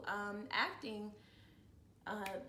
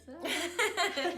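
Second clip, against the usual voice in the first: loud, high laugh after the toast; big giggling burst; burst of laughter; 9 semitones higher, 7 dB louder than usual. Real, not acted.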